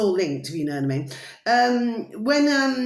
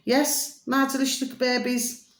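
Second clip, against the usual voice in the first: normal talking voice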